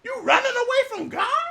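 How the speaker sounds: high, loud disbelieving cry; frightened sailors. Acted. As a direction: shout it in panic at the runaway. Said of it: "And said".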